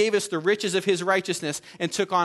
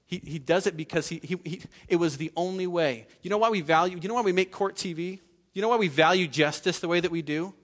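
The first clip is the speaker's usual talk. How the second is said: normal talking voice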